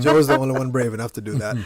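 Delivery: loud, quick, through laughter; laughing tease. Real, not acted. Teasing Joe amid shared laughter.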